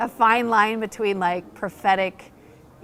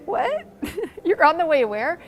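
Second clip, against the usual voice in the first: squeaky high What, laugh, then a louder question; astonished retold reaction; 7 semitones higher, 5 dB louder than usual. [name] retelling her shock at the Foursquare president's news, high and loud. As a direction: react as if you can't believe it.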